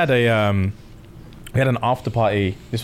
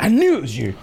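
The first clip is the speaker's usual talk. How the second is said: high, gleeful gotcha; excited recognition; 8 semitones higher, faster than usual. Retold or imitated. Delivery guy gleefully catching the customer. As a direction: crow it like you caught him.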